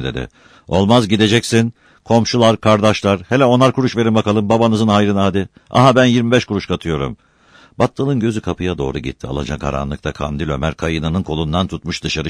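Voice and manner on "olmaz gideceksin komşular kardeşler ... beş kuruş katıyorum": raised voice addressing a crowd; insistent, higher-pitched public plea; rallying appeal. Acted. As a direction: call on neighbours to chip in. Appealing to crowd for fare money.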